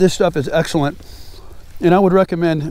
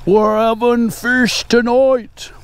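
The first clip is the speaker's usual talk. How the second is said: high, slow, menacing shark voice; shark from Finding Nemo; 5 semitones higher, much slower than usual. Retold or imitated. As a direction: drag it out like a hungry shark. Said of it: "that big s-, uh, shark goes".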